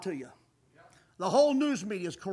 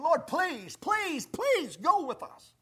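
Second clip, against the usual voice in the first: high, pleading please, slowing on the last one; imitating Moses begging the Lord; retelling Moses pleading with God; 6 semitones higher, much slower than usual. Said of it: "he just starts begging".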